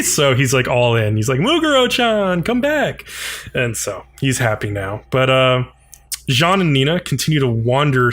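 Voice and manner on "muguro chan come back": put-on much higher, sugary call to a child; sing-song coaxing. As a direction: coo the kid back like a doting uncle. Bacchus now eager to babysit.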